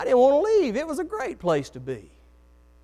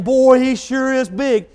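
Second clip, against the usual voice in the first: slow, higher, louder awed fear; imitating scared soldiers; 7 semitones higher, 9 dB louder, slower than usual. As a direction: drag it out like a frightened onlooker. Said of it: "Everybody else was scared and saying".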